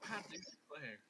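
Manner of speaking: softly whispered Southern affectation; mock Southern belle. Players riffing on going Southern, mock Southern belle.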